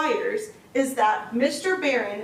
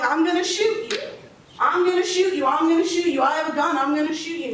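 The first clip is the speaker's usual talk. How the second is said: mock yell; 5 semitones higher than usual. Prosecutor to jury, acting out the defendant's threats as a mild mock yell. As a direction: act out the defendant yelling threats through the door, not full force. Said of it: "she's yelling".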